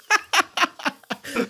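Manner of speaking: high-pitched run of giggles; laughing. Real, not acted. Amused by a friend's teasing, high laughter. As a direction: keep laughing, high and helpless.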